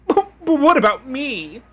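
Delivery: very high, pathetic "But what about me?"; pathetic whine. Shown as a demonstration. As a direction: whine it like a pitiful diva. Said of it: "in a very pathetic way".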